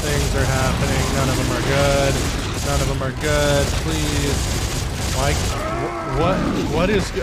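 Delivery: loud frantic yelling in panic; yelling while running away. Real, not acted. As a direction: yell it while fleeing in panic. Fleeing fire in game.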